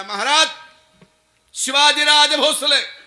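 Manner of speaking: shouted announcement. Acted. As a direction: call out the king's arrival at full voice. Soldier runs in announcing the arrival.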